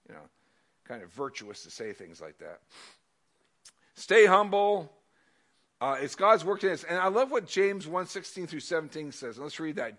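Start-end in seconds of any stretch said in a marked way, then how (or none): none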